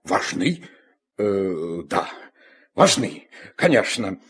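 hesitant musing. Acted. Father mulls over teacher's claim.

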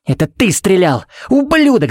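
high, enraged outburst; yelled insult at full pitch; furious accusation. A narrator's reading. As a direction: hurl the accusation, voice climbing sharply. Furious accusation after the yell.

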